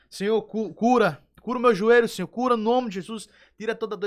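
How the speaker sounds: loud, high crying-out prayer for healing; shouted prayer. Retold or imitated. Man on his knees begging God to heal his knee, shouting. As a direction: cry out to the Lord at full voice. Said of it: "Eu comecei a gritar".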